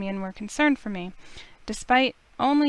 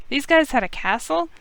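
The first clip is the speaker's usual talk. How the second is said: surprised; 5 semitones higher, 5 dB louder than usual. Acted. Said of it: "couldn't help but yell in surprise".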